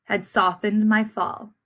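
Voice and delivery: put-on gentle read-aloud to young listeners; story narration. A narrator's reading. Teacher reading the class a picture book, storytelling voice.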